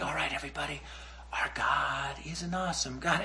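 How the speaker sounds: hushed, embarrassed mock worship leader; whisper worship. Embarrassed kid with guitar leading worship among mockers, whispering. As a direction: imitate leading worship under your breath. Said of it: "did some whisper worshiping".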